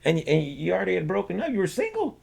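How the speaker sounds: high-pitched incredulous reasoning; acted baffled protest. Guest retells arguing with divorced parents, baffled protest.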